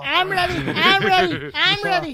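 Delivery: high loud squeaky cartoon voice; SpongeBob voice. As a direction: shout it like an eager sponge. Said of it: "Tom Kenny".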